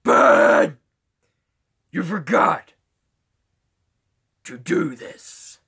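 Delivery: loud put-on holler, slowing at the end; Bat Dad. Prankster addressing Ben at church, imitated Bat Dad voice. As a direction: bark out the name, then drag the rest. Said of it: "I went up to Ben and went like".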